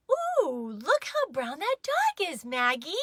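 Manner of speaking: a high, lilting mom voice, a little hammed up; Mama's voice. A narrator's reading. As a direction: sing it up high like Mama admiring the dog. Mama voiced in retold story.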